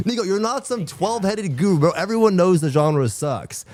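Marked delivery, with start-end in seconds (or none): none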